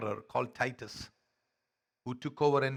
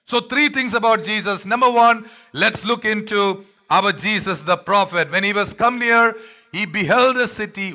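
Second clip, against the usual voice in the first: full-force preaching; 6 semitones higher, 11 dB louder than usual. Acted. Pastor to congregation, loud high preaching. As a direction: proclaim it to the whole church.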